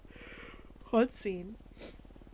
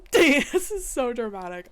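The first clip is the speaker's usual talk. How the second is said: laughing, louder and higher; laughing exclamation; 4 semitones higher, 11 dB louder than usual. Real, not acted. Judge laughing loudly at the dramatic case report.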